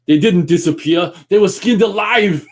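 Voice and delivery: loud, high, outraged Austrian action hero; Arnold Schwarzenegger. Acted. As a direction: belt it out like a movie commando. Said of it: "this line from Predator I'm really good at".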